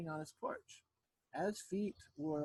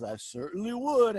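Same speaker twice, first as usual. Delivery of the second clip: loud, higher lion reply; eager lion; 5 semitones higher, 11 dB louder than usual. A narrator's reading. Lion accepts Anansi's walk, eager and hearty. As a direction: accept heartily, well above normal volume.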